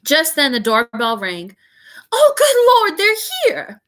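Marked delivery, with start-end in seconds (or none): 2.1-3.8 much higher, loud panicked cry; frantic. A narrator's reading. Aunt panicking as doorbell rings, high and loud. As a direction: squeal it in a fluster. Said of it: "said Aunt Petunia frantically".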